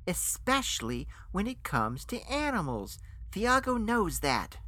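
high-pitched indignant defence; insistent. A narrator's reading. Guest defends his ethics about animals, insistent and high. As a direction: keep protesting, pitch climbing. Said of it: "I insisted".

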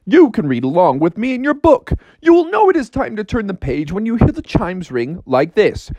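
high-pitched storybook record intro; high, performed read-along announcer; read-along storybook narrator. Acted.

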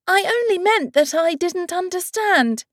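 put-on a puzzled young girl; Alice. Alice explains herself.

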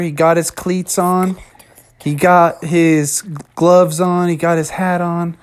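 usual speaking voice

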